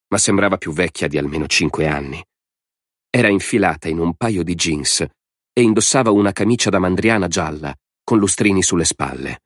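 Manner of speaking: usual speaking voice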